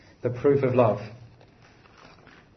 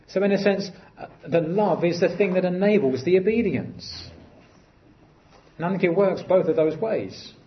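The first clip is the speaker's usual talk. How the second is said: normal talking voice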